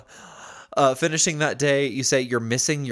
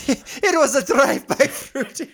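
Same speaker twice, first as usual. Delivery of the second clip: high-pitched, muddled Scottish-Russian voice through laughter; Scottish-Russian accent; 14 semitones higher, 4 dB louder than usual. Acted. Cohost echoing the line back, laughing Scottish-Russian accent. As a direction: echo it in a silly Russian-ish accent. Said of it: "Scottish and Russian".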